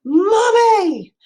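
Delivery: high, loud shriek of Mommy!; child shrieking for her mother from bed. Retold or imitated. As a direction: shriek down the hallway in alarm. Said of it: "she shrieked".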